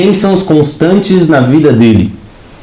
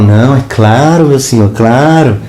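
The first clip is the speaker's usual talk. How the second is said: bow and scrape, lightly hammed; fawning politician; 5 semitones lower than usual. Preacher mocks a flattering politician, obsequious.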